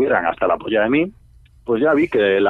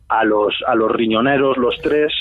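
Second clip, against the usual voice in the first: normal talking voice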